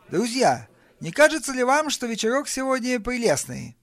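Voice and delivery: high, rousing toast to the guests; rally the room with an upbeat high pitch; toastmaster's address. Acted. Brian hails the party guests, high-pitched toastmaster's address.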